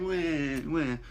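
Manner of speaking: soft mock wah wah of a crybaby; soft fake baby cry; mock baby wail. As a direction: tease like a crybaby. Mocking crying baby.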